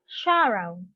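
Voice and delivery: "Sorrow" with the first syllable stressed. Shown as a demonstration. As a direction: say "Sorrow" as SOR-row for learners. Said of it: "stress on the first syllable".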